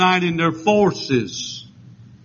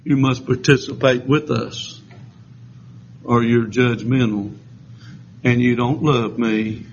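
lower-pitched, measured retelling of their ultimatum; understated mock demand; 5 semitones lower than usual. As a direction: drop down and voice their accusation soberly. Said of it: "but now they're saying".

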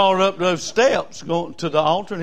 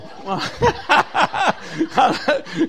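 hearty laugh; 8 semitones higher than usual. Real, not acted. Laughing at being old.

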